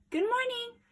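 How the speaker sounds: high sing-song greeting to the animals; chirpy little girl greeting; cheerful call. Acted. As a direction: call out happily across the woods. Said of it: "she called to the rabbits".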